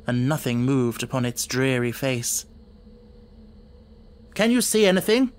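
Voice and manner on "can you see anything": anxious question. A narrator's reading. Holmes searching the dark moor.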